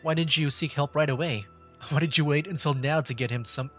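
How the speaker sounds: usual speaking voice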